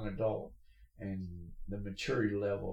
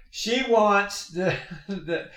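slow and high, laughing it out; amused disbelief in the voice; chuckling through the setup; 10 semitones higher, 12 dB louder, much slower than usual. Real, not acted. Laughing retelling Starbucks story.

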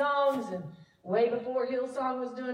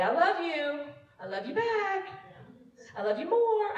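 lightly voiced child, higher pitch; child saying I love you; 6 semitones higher than usual. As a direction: pitch it up like a little kid. Voicing a child saying affection.

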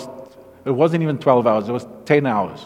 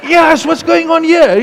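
much higher and louder, bursting; excited amazement; 11 semitones higher, 4 dB louder than usual. Retold or imitated. To the guy at camp, high excited bewilderment. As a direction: ask it overwhelmed with wonder.

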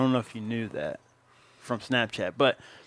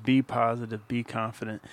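ordinary talk

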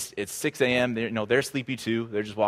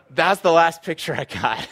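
higher and louder, nearly laughing; punchline emphasis; 7 semitones higher, 6 dB louder than usual. Real, not acted. Pastor to congregation, punchline said higher, louder, nearly laughing. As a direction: land it as the big reveal with a grin.

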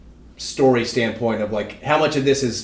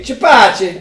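put-on Italian accent; 9 semitones higher, 7 dB louder than usual. Italian-ish exclamation.

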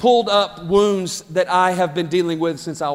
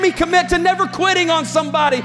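preaching at full force; 10 semitones higher than usual. Acted. Pastor rallies the congregation, preaching at full force. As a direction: preach it over the music, building.

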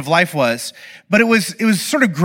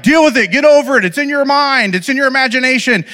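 dismissive parent; 7 semitones higher, 3 dB louder than usual. Retold or imitated. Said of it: "This whole time I've just been like".

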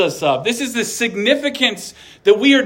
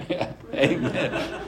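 soft, slow chuckled amen; amused, hushed and drawn out; laughing agreement; 3 semitones lower, 9 dB quieter, slower than usual. Real, not acted. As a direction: laugh softly through the words.

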